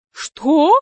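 high, stunned question; astonished. A narrator's reading. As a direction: ask as if floored by the news. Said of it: "Мария Павловна так удивилась".

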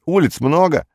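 high-pitched matter-of-fact retort; shop assistant. A narrator's reading. Shop assistant to Perets about many streets, high-voiced. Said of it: "спросил продавец".

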